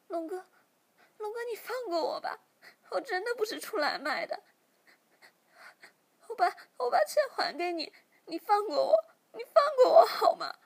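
high, loud sobbing, rising to a wail; crying for mercy. Acted. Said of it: "小喵马上哭着求饶说".

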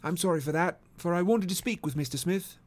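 a hint of a polite English sleuth; courteous detective. Detective asks after the husband, lightly voiced and courteous.

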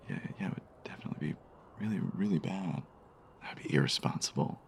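quick unvoiced whisper. Whispering back to her friend, agreeing in a hush. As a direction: whisper back, hurried and hushed. Hushed reply in kind.

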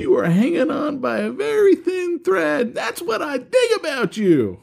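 high-pitched hammed-up movie quote; over-the-top excitable impersonation; Rod Tidwell. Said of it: "said in my best Rod Tidwell impersonation".

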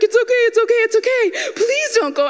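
high, frantic mock pleading; begging. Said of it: "I'm begging her".

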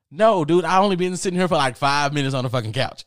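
loud, high-pitched objection; acted-out outraged denial; incredulous protest. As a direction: argue back at full volume.

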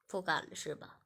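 lightly voiced master pleading his excuse; low master voice. Master excusing himself to Shiya, low and coaxing.

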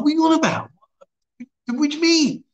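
high-pitched, incredulous retort; baffled protest. Retold or imitated. Acted quote, baffled by frog-licking request.

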